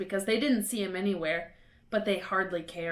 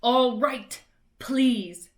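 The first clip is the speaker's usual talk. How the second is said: louder, through the teeth; grudging Filch; 3 semitones higher, 7 dB louder, much slower than usual. A narrator's reading. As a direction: give in grudgingly.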